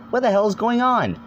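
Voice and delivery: confused outburst. Acted. Tahu confused on waking.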